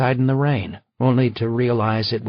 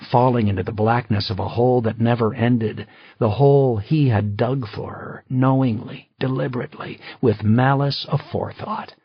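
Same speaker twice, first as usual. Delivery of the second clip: plain reading voice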